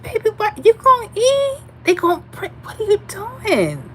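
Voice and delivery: put-on high, incredulous baby talk to the plate-piling girls; mock baffled high voice. Asking the thin girls about their piled plates, high mock disbelief. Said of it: "I said".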